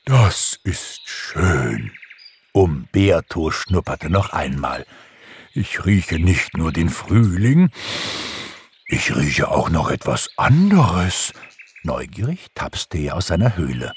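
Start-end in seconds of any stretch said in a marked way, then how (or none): 0.1-1.9 very slow, drawn-out bear. A narrator's reading. Bear enjoys smelling spring flowers, in bear voice. 5.5-11.3 put-on character voice of a bear. A narrator's reading. Bear sniffs something beyond spring, in curious bear voice.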